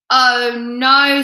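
loud, mildly dismayed bear; a touch of storybook exasperation; exasperated bear. A narrator's reading. Weary bear cannot sleep.

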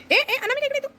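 very high, sharp call to the bird; calling out to pet. Real, not acted.

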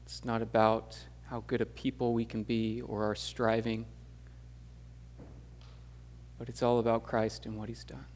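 soft, hushed prayer voice; quiet prayer. Acted. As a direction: pray softly to God. Closing prayer before the sermon.

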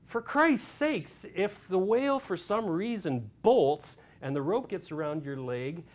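loud, high exasperated yell; exasperated tirade ending on a shouted command; frustrated outburst. Retold or imitated. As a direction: imitate an explosive man losing patience. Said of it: "getting very frustrated".

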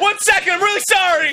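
high, loud apologetic shout; frantic call through a bathroom door; super frantic. Acted. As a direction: stall them with a panicked yell. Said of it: "Super frantic".

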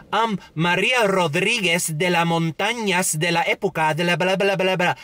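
mock newscaster switching into showy Spanish pronunciation.

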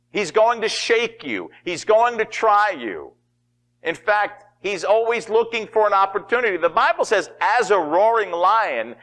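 high, emphatic preacher's proclamation; forceful preaching. Real, not acted. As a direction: drive each phrase home to the congregation.

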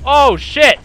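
sudden high loud yelp of surprise; startled shout. Real, not acted. Gamer ambushed by an enemy, startled shout. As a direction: jolt out the words, caught off guard.

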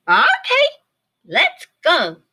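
very high, loud little bird voice; Pip agreeing. A narrator's reading. Pip the bird agreeing to go, high and loud. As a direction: chirp it out eagerly. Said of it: "agreed Pip".

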